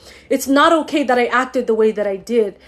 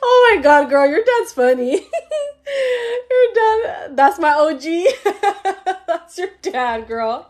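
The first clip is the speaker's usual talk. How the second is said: breaking into laughter while crying, pitch jumping up; real laugh-cry, overcome with emotion; laughing through tears; 7 semitones higher than usual. Real, not acted. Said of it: "I'm just feeling everything right now".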